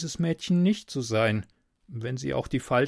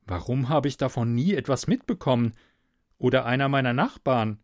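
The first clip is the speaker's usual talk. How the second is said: ordinary delivery, nothing marked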